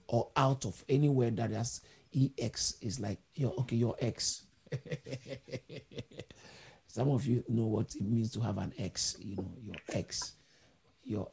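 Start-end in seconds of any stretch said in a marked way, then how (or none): 4.7-6.2 soft chuckle. Real, not acted. Preacher joking about your ex, soft chuckle. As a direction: chuckle softly at your own joke.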